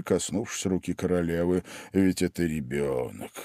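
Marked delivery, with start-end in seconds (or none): 1.9-3.3 low, soft, meek reminder; timid king. A narrator's reading. King pleads for the child Alice, timid and soft. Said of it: "робко проговорил король".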